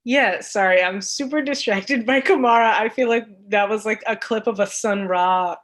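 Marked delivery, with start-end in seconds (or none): none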